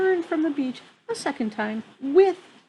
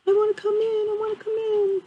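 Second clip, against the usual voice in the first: high, pleading little otter voice; 11 semitones higher, 3 dB louder than usual. Acted. Reader voicing the otter begging to come in, high and pleading. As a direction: squeak it like an otter begging at the door.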